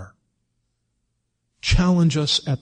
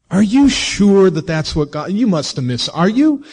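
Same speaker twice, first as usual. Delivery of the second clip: high, louder, sneering question; mocking tempter; 6 semitones higher, 3 dB louder than usual. The enemy mocking Jesus about God's word, high sneer. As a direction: needle him with mock disbelief.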